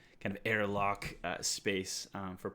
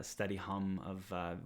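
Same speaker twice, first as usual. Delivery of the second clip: normal talking voice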